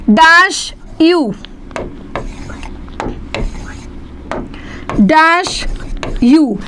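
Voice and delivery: डैश यू drawn out, the यू set apart; blank read slowly. Shown as a demonstration. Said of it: "next question". (डैश यू को धीरे-धीरे पढ़ना)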